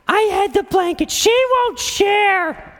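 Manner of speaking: put-on high, slow whining child; whiny little girl. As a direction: whine like a tattling little girl. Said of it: "listened to them all day long bickering".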